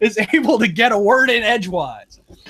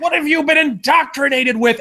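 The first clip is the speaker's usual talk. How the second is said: mock outraged teacher; 7 semitones higher than usual.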